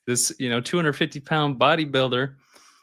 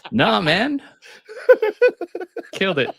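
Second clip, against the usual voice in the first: higher, laughing reassurance; laughing tease; 11 semitones higher, 4 dB louder than usual. Retold or imitated. Instructor telling the shaken customer he killed it, laughing. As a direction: tease him while cracking up.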